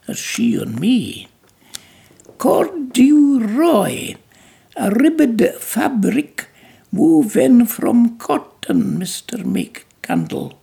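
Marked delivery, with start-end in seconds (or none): 2.4-10.5 high, slow, careful recital of a new word; childlike recitation. Acted. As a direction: repeat the lesson proudly like a good pupil. Belle repeats the lesson.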